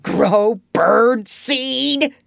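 a very slow, loud disgusted groan. A narrator's reading. Pirate Ben Ali hears he must farm, groaning in disgust. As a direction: drag the words out in revolted disbelief. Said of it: "he groaned in disgust".

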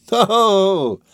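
high, loud ho-ho laugh. A narrator's reading. Charlie delighted by the courtroom picture, laughing. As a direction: burst into a boyish guffaw. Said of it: "laughed Master Bates".

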